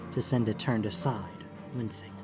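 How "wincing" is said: ordinary delivery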